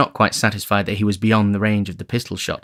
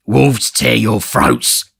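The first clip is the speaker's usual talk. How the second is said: slow, growled malediction; muttered curse; 5 dB louder, much slower than usual. A narrator's reading. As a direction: grind the curse out through gritted teeth. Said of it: "muttered Sikes, grinding his teeth".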